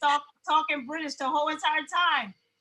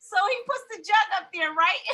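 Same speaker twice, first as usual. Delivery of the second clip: ordinary talk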